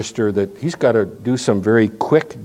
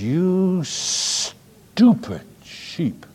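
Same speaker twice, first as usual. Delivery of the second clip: slow, drawn-out mock scold, higher; mock scolding shepherd; 6 semitones higher, much slower than usual. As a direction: sneer it out slowly at the sheep. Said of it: "and says".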